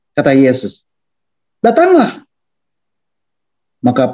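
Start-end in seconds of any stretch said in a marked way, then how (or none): 1.6-2.3 high-pitched summons, falling at the end; calling out. Acted. Jesus summons Peter out of the boat, calling out. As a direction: call him over in a high voice.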